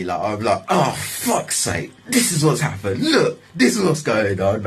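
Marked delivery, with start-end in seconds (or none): 0.7-1.9 high, slow exasperated curse; rowdy exasperation. Retold or imitated. Man on the floor as police approach, rowdy curse. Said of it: "I'm getting rowdy". 2.1-4.7 high-pitched retold rant; ranting and raving. Man on the floor protesting, mock ranting and raving. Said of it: "proper ranting and raving".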